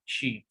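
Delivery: "She" said as "shi". Shown as a demonstration. Teacher shows E sounds like I, "She" as "shi". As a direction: say "She" with an "ee" vowel. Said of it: "la letra E suena como una letra I".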